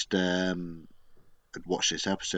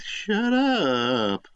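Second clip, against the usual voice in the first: high, louder mock Homer snap; imitating Homer; 9 semitones higher, 4 dB louder than usual. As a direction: fling it back like a sulky Homer. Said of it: "and Homer goes".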